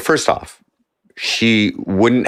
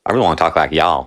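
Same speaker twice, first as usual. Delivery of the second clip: quick, lower mock Southern accent; 4 semitones lower, faster than usual. Wanting to talk like them, quick mock Southern accent. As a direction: imitate a Southern twang quickly. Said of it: "try and talk like them".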